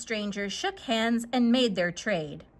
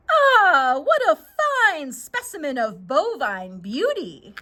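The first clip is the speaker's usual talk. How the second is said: admiring stranger; 3 semitones higher, 3 dB louder than usual. Acted.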